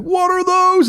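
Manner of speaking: high, loud mocking voice; high loud mocking commenter. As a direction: mimic the snide commenter. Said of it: "someone's like".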